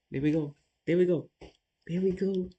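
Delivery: loud, high triumphant cheer; excited cheer. Real, not acted. Streamer cheering his own winning move, loud and high. Excited chess win celebration.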